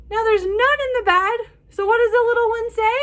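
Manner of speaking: high, quick and bright with excitement; excited. Real, not acted. Excited reading to children.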